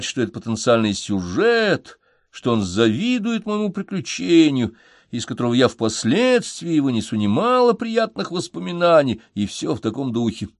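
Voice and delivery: ordinary delivery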